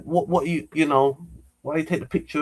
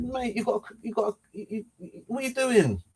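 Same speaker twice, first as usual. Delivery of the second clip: high-pitched, stammering indignation; high stuttered objection, retold; indignant protest; 7 semitones higher, 6 dB quieter than usual. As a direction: protest in disbelief, voice jumping up high. Retold startled protest at being photographed.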